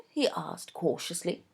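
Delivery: plain reading voice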